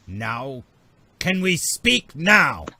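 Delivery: slow, loud Nemodian demand, rising pitch; angry Nemodian accent. Acted. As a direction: drag out an irritated Nemodian alien. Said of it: "very angry-faced Nemodian".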